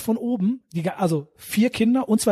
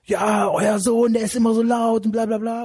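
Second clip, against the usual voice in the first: mock furious neighbor; 3 semitones higher, slower than usual. Neighbor ranting at the couple at night, mock furious yell. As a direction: ham up the yelling neighbor. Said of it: "da ist der Typ voll ausgerastet".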